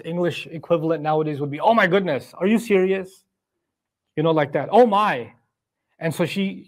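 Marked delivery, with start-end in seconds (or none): 1.6-3.2 acted shock, higher pitch; shocked exclamation. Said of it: "an expression of shock also". 4.7-5.3 louder, higher acted gasp of shock; shocked exclamation. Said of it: "an expression of shock also".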